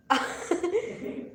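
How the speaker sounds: short laugh, rising in pitch. Real, not acted. Streamer amused by viewers' chat on her live, laughing. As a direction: let out a brief high laugh.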